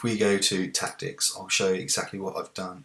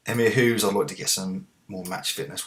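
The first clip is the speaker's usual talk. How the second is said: normal talking voice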